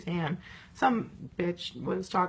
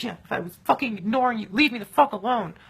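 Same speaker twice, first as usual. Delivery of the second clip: retold angry shout; 8 dB louder than usual. Telling off a pushy stranger.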